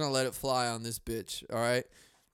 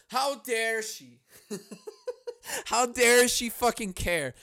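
mock outrage; 8 semitones higher, 4 dB louder, slower than usual.

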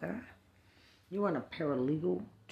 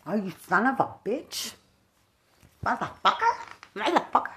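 loud, higher cursing bursts; angry cursing; 5 semitones higher, 5 dB louder than usual. Real, not acted. Cursing the absent woman in a loud angry rant. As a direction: spit each curse out in fury. Ranting alone, cursing loudly.

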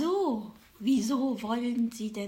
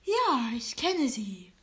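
ordinary delivery

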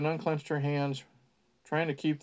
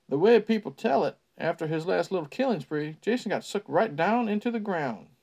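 put-on folksy old local spinning a tale; old country man; 4 semitones higher than usual. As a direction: spin it like a creepy small-town storyteller. Ned's line in character.

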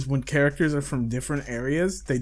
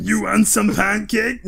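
slow, higher, louder cartoon voice; goofy dub voice; 7 semitones higher, 5 dB louder, slower than usual. Quoting the elephant clip in a goofy voice. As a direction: deliver it like a silly dub line.

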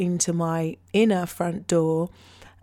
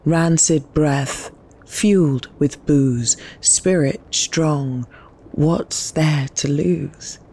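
slow, louder spoken-word reading with long pauses; poem reading; 3 semitones lower, 5 dB louder, slower than usual. Acted. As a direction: drop each word into the silence like a weight. Reads her poem over soundscape.

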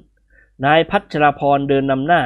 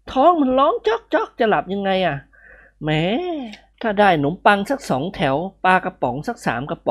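higher, whining hungry friend; character voice; 6 semitones higher than usual. A narrator's reading. Hungry friend complaining he cannot sleep, higher whine. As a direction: whine about hunger in a higher voice.

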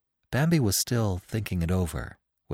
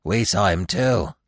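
ordinary delivery, nothing marked

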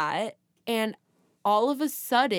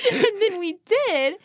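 higher and louder through laughter; laughing; 10 semitones higher, 5 dB louder than usual. Real, not acted. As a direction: laugh the words out. Laughing while telling.

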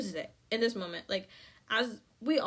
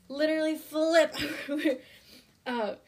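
ordinary delivery

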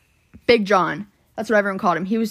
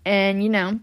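ordinary talk

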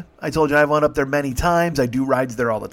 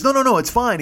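insistent; 10 semitones higher, 3 dB louder than usual. Retold or imitated. Drunk passenger insisting he's fine.

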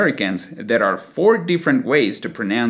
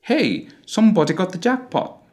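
higher British accent, rounded O in every word; British closed O; 8 semitones higher than usual. Shown as a demonstration. Teacher showing the British version, closed O accent. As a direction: round somebody, got, jackpot British style. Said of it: "we will use the closed O sounds in all the words".

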